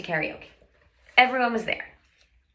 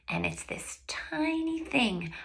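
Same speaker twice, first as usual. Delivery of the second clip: hushed whisper; 7 semitones higher, 8 dB quieter, slower than usual. Real, not acted. Hushed moment of her story.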